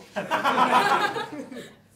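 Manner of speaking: loud real laugh; laughing hard. Real, not acted. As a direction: burst out laughing.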